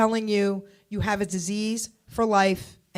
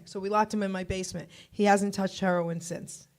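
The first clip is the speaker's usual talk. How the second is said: usual speaking voice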